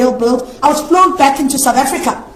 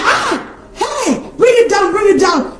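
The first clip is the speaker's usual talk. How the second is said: mock shout; 7 semitones higher, slower than usual. Pastor shouting at her to pull it down. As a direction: bark it out like an angry pastor.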